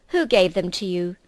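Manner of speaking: sharp demand. Acted. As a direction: bark the question at the fox. Witch interrogates frightened fox.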